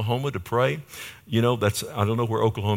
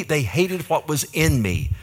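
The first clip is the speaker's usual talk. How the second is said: ordinary talk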